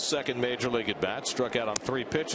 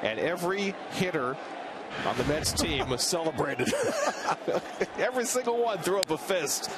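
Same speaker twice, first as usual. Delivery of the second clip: laughing; 5 semitones higher than usual. Real, not acted. Laughing while describing celebration.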